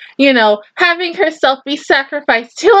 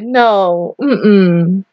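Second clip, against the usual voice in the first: normal talking voice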